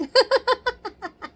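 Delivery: real loud laugh; high loud laugh. Real, not acted. Laughs after comeback.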